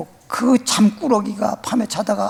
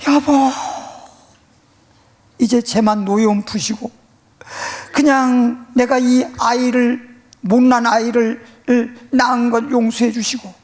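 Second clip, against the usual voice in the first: tearful pleading wife; 5 semitones higher than usual. Retold or imitated. Wife begging husband to spare the child, tearful. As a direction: plead through tears. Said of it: "막 애원하면서 눈물 흘리는 거예요".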